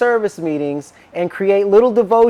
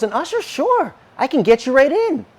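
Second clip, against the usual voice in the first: retold breezy assurance; 8 semitones higher than usual. Retold elder readily agrees.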